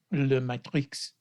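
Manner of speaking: drawn-out, hammed-up French accent; bad French accent. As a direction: put on a terrible French accent, slowly. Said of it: "fucked up French accent in this podcast".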